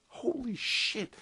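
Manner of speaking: high, slow, stretched-out astonishment; shocked disbelief. Real, not acted. Caster rants about overpowered Stalkers, astonished. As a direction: let it rise way up in disbelief. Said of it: "Stalkers are ridiculous".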